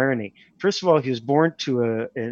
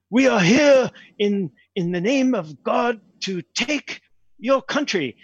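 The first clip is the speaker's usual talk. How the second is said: slow, high-pitched mock proclamation; English soldier; 8 semitones higher, slower than usual. English soldier to an Indian farmer, mock proclamation. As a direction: declare it slowly as a mock invading English soldier. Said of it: "some English, uh, soldier walks in".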